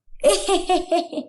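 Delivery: a short scornful laugh at the daughter-in-law; mocking laugh. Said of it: "जो इतना हंस रही हो आप". (ताना मारती हंसी)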